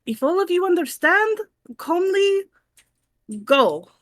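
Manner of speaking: loud, booming commanding call; magically amplified voice. Acted. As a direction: project calm orders to the crowd. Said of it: "with, like, the thaumaturgy".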